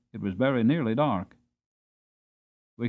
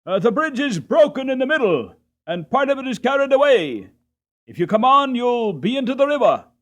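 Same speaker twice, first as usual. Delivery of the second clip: much higher, louder urgent warning; shouted warning; 12 semitones higher, 7 dB louder than usual. Acted. Toll-gate man shouting a warning across the bridge. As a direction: yell the danger across the river.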